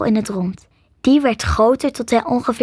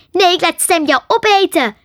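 high, raised-voice outburst; cross, exasperated cry; angry shout; 8 semitones higher, 5 dB louder than usual. A narrator's reading. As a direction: snap in anger and threaten. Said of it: "schreeuwde Marie boos".

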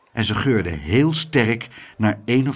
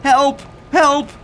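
high, loud cries for help; calling for help; 13 semitones higher, 5 dB louder than usual. Acted. Abeltje cries for help while dragged off, high and loud. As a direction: yell for rescue. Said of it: "riep hij".

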